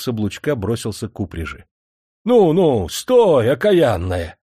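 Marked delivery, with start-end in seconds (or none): 2.2-4.4 coachman yelling at the horse, higher and louder; shouting at the horse. Acted. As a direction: rein in the panicked mare with a yell. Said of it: "Набросился он на пристяжную". (кучер громко кричит на лошадь)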